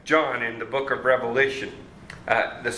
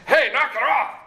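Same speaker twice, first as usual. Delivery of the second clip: blunt rebuke; 10 semitones higher, 3 dB louder than usual. Retold or imitated. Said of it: "so you just don't say".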